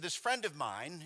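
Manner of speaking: slow, lightly imitated British accent; a gentle take-off of a British speaker. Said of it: "he was saying it very Britishly".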